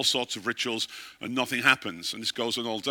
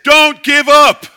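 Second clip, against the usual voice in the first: much higher, louder, slow "Don't give up."; urgent plea; 16 dB louder, much slower than usual. Retold or imitated. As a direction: urge it slowly, word by word. Retold caller's message on phone.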